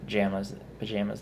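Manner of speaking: "pajamas" said puh-JAM-uz, softly; pa-JAM-as pronunciation. Shown as a demonstration. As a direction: middle vowel of "pajamas" as in "jam". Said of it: "I don't know why I did".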